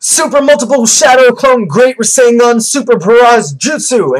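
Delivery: loud, high, drawn-out attack shout; Naruto. Retold or imitated. Vlogger acting out Naruto's big attack, high full-force shout. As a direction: bellow the long technique name with hammed-up force. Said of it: "Naruto's like".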